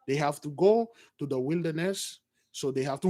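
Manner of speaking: low, soft, measured prophet's plea; calm envoy Aaron. Acted. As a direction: address the king gently and respectfully. Aaron speaks to the king.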